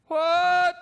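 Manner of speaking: put-on deaf old man. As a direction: play the deaf old man who missed it all. Deaf man voiced in a story.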